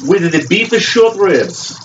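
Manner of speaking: a hammed-up, mixed-up French chef accent; mock French accent. Host welcoming viewers to his kitchen, in a mock French accent. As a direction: play a pompous French chef, lightly overdone. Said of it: "I don't know what accent I'm using".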